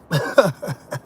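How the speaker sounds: low staccato chuckle; laughing. Real, not acted. Sure his own side is good, chuckling. Laughing after "synced about.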